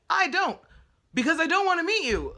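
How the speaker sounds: high, loud and fed up; exasperated refusal. Retold or imitated. Quoting her own reply to the stalker, exasperated and loud. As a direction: slam the door on him with every word.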